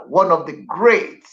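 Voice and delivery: ordinary talk, nothing marked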